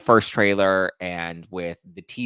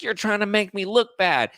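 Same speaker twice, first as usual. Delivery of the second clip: mock accusing wife; 11 semitones higher, 3 dB louder than usual. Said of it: "she's like".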